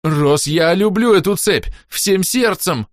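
slow, high, loud pleading; panicked pleading. A narrator's reading. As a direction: beg in panic for the chain. (паническая мольба)

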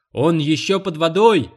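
high, loud gleeful yell; shouting together. Acted. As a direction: yell it out on the count of three. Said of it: "дружно крикнули Данкин с Уолтом".

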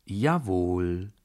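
JAWOHL drawn out with a rising end; drawled. A narrator's reading. Narrator answers the furious baron, drawled. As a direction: stretch the long O in Jawohl, unhurried. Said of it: "sagte ich gedehnt".

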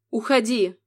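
plain reading voice

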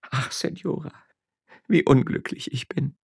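high, plaintive lament; sobbing like a child. Acted. Miller's wife to her comforter, childlike sobbing lament. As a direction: wail like a child seeking mother's arms. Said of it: "sagte schluchzend wie ein Kind".

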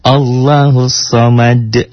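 slow Allahus Samad, s with light breath; soft-breath Allahus. Shown as a demonstration. Teacher shows a gentle, breathy s in Allahus Samad. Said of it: "anginnya halus".

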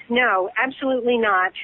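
ordinary talk, nothing marked

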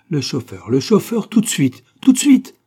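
building to a high, loud shout; urgent summons. A narrator's reading. Master calling his valet for the chauffeur, urgent shout. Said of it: "lança-t-il à son valet de chambre".

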